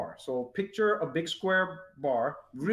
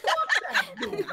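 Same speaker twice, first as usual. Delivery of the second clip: wife's retort. Retold or imitated. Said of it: "He's like".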